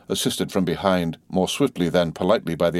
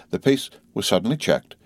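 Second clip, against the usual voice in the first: ordinary delivery, nothing marked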